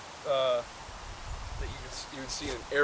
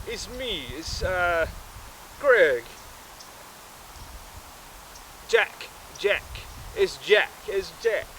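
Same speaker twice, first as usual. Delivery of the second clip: higher-pitched phone greeting; raised, punchy caller voice; main guy on phone; 5 semitones higher than usual. Acted. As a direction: announce yourself down the phone. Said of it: "and so he was like".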